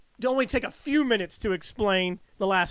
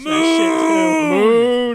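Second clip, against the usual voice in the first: loud groaning complaint; exasperated shout; 6 semitones higher, 6 dB louder, much slower than usual. Real, not acted. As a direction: shout it in mock despair. Dread shout.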